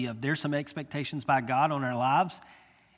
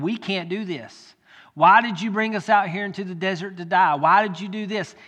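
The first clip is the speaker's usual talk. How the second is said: complaining cry; 4 semitones higher, 7 dB louder than usual. Acted. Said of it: "They said".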